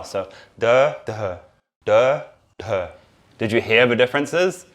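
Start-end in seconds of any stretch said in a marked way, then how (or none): 0.6-2.9 "d, d, d, d" as four distinct Hindi D's, dental and retroflex, plain and breathy; four Hindi D sounds. Shown as a demonstration. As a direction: say "d, d, d, d" one by one, each a different Hindi D. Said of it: "There's four D sounds as well".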